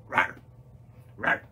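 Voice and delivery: playful little dinosaur roars; short dinosaur roar. Pretend. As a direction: give two quick pretend roars. Dinosaur roars after the song.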